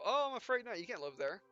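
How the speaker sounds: ordinary talk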